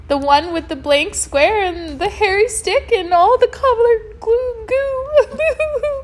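very high, loud mock blubber. A narrator's reading. Dwayne describing the painter to Cece, blubbering. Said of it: "blubbering about being captured by a man".

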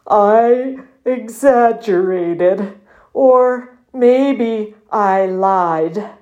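very slow, miserable muskox voice; croaking with misery. A narrator's reading. Muskox confessing to his friend, croaking with misery. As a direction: croak each word out wretchedly. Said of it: "his voice croaked with misery".

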